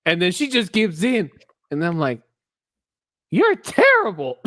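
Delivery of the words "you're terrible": high-pitched mock-scandalized cry; mock outrage. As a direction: squeal it in playful outrage. Reacting to the film aloud.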